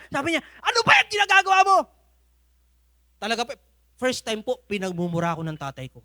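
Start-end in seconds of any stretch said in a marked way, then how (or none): 0.6-1.8 high, loud, fast furious yell; angry father. Retold or imitated. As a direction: shout it at your failing son. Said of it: "galit na galit siya". (sumisigaw na galit na tatay, mabilis at malakas)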